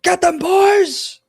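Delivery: high, loud, slow battle yell; a captain yelling the order to open fire. Acted. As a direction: roar the order to the crew. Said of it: "he just kinda yells out".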